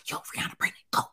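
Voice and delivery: whispered news to husband; wife whispering to husband off camera; whispered tip. Retold or imitated. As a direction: whisper it urgently to her husband. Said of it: "I'm like".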